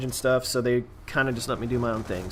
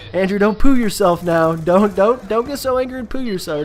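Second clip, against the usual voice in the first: a light mock-calming girlfriend, higher and louder; teasing girlfriend; 7 semitones higher, 6 dB louder than usual. Girlfriend mocking him whenever he gets angry, light teasing. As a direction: playfully tease him to calm down. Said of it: "she's like".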